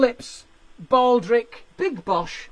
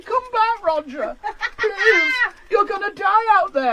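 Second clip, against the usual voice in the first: high, loud, pleading wife calling him back; 9 semitones higher, 5 dB louder than usual. Retold or imitated. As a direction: beg him to come back from the driveway. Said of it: "She's going".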